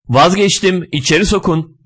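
the stuck man begs to go back; pleading. A narrator's reading. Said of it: "diye yalvarıyor".

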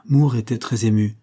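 ordinary delivery

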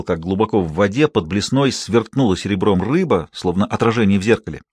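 usual speaking voice

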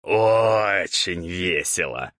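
slow, stretched-out drawl. A narrator's reading. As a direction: draw the words out lazily. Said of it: "протянул учитель".

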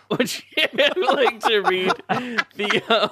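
cracking up mid-sentence, high and loud; high, slow, broken by laughs; laughing. Real, not acted. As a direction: squeeze the question out through real laughter. Cracking up while handing over the reading.

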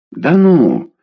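обиженно буркнув. A narrator's reading. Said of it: "обиженно буркнул Игорь".

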